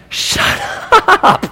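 high, strained "shut up" outburst; exasperated mock shout. Teammates to the kid who answered the coach, exasperated mock shout. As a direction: imitate teammates yelling in exasperation. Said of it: "everyone's like".

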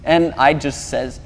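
ordinary delivery

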